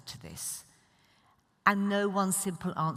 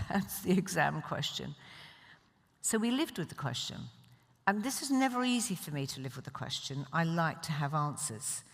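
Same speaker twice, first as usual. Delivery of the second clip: usual speaking voice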